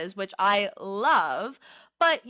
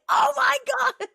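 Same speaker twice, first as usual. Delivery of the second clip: much higher, louder mock-excited shriek; excited squeal; 4 dB louder than usual. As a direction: squeal like the news just dropped. Imagined excited text to guest.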